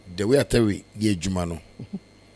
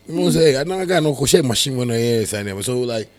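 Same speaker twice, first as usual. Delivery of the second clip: normal talking voice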